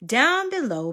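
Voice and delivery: ordinary delivery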